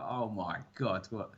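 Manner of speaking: ordinary delivery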